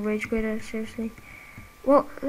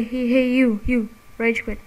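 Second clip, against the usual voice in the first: ordinary delivery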